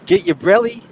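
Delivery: high, loud hammed-up Aussie shout; mock Aussie accent. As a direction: yell "brelly" like a cartoon Aussie. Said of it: "Do they call 'em brellies down there".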